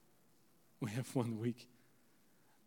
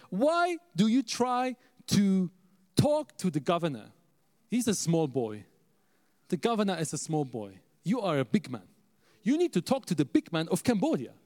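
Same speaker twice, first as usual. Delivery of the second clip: emphatic Ghanaian elder, imitated; Ghanaian accent; 4 semitones higher, 4 dB louder than usual. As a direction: scold and advise in imitated Ghanaian English. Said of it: "so he speaks a little different".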